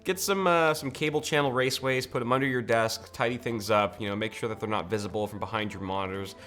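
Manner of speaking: normal talking voice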